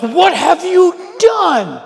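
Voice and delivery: terrified shout. Retold or imitated. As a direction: shriek it in horror. Terrified sailors cry out.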